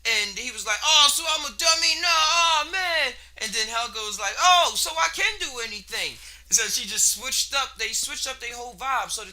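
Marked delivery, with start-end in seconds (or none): 0.8-3.1 loud, much higher cry of disbelief; dismayed shout. Retold or imitated. The bully finds out his test was switched: loud dismayed shout. 4.4-6.2 higher and louder, thrilled discovery; excited realization. Retold or imitated. Helga learns she was smart all along: thrilled exclaim.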